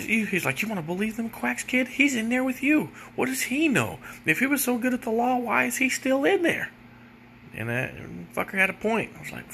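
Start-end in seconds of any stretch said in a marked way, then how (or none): 0.6-6.7 much higher, quicker, scoffing lawyer mock. Lawyer scoffs at the jailhouse lawyer in a high mocking voice. Lawyer mocked in retold story.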